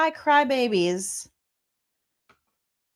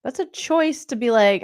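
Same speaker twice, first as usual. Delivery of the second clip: ordinary delivery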